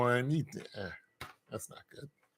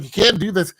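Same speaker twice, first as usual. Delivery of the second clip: the Lord forbidding; 7 semitones higher, 7 dB louder than usual. Retold or imitated. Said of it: "He's like".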